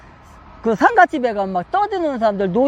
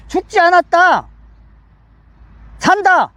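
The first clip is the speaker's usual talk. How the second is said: raised pitch, short separate pronouncements; emphatic declaration; 5 semitones higher than usual. Acted. Preacher as Jesus about the girl, emphatic declaration. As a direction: proclaim each phrase firmly.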